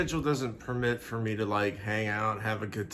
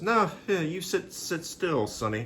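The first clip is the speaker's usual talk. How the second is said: high mock scolding voice; fate telling him to wait; 6 semitones higher than usual. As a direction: play fate scolding a kid, sit still. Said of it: "intervenes and says".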